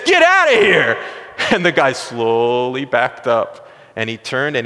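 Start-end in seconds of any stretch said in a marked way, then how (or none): 0.0-1.0 higher, shouted order; yell. Retold or imitated. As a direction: drive the intruder out with a yell. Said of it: "yells at him and screams at him".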